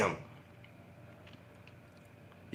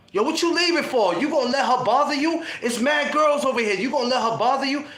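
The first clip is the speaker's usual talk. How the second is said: calling after him; 6 semitones higher than usual. Retold or imitated. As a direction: yell after your boy to stay.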